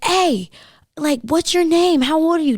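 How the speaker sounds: high, loud mock yell after her; shouting after a car, imitated; retold screaming. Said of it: "I could hear him screaming".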